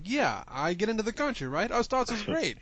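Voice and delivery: put-on high, loud goofy old man; weird old man voice. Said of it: "this weird old man".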